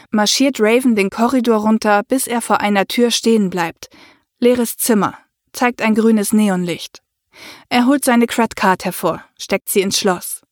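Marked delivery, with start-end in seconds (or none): none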